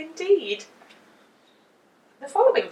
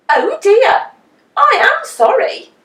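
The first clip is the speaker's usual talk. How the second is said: high, loud mock apology; Mister Brush; 12 semitones higher, 12 dB louder than usual. A narrator's reading. The painter pretending to apologise to Mister Nosey, high and loud. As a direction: fake sorry, pitched up and loud. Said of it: "said Mister Brush".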